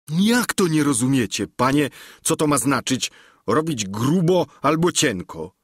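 plain-spoken servant arguing with his master, lightly played; Sancho Pansa. A narrator's reading.

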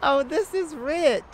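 loud laugh spilling into the words; delighted laugh. Acted. Thief mocking the blushing cop, laughing. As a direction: crack up at his embarrassment.

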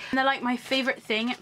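ordinary delivery

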